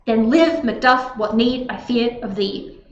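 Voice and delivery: weight on "live", "duff", "need", overdone; overemphasized iambic stress. Shown as a demonstration. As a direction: lean hard on every second syllable, "Then LIVE, MacDUFF, what NEED I FEAR of THEE". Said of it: "overemphasizing it".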